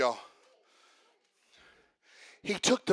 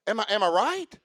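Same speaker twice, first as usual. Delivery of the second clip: usual speaking voice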